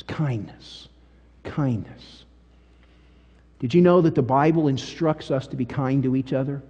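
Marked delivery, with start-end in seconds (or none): 0.0-2.2 hushed repetition. Real, not acted. Repeated word for emphasis.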